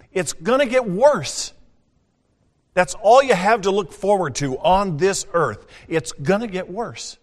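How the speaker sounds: high, loud pulpit preaching; fiery preaching. Acted. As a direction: warn the congregation with full force. Preaching at full force.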